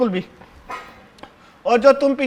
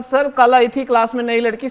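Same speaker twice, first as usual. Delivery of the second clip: ordinary talk, nothing marked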